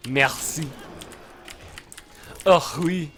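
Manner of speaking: playful put-on French accent. Showing off to viewers, French accent. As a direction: show off a mock French accent. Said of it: "Do you like my French accent?".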